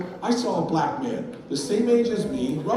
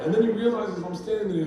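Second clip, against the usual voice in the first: normal talking voice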